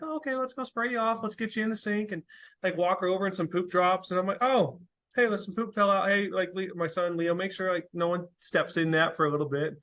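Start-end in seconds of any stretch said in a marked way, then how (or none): 0.0-2.1 put-on much higher, soft reassuring toddler talk; gentle to a toddler. Dad soothing his daughter after an accident, high and gentle. 4.4-9.8 higher, light sing-song call; cheery call to the kids. Retold or imitated. Dad telling his son to guard the mess, high and light.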